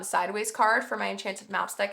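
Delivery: usual speaking voice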